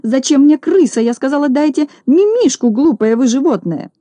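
high, exasperated reproach; acted haughty exasperation; indignant scolding. Irritated mistress scolds the detective.

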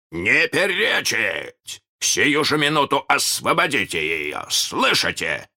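slow, high commanding captain; Captain Hook voice. Pretend. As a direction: bark orders as the captain. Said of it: "кричал Питер".

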